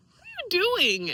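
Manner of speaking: exasperated. Retold or imitated. Confronting her father, exasperated.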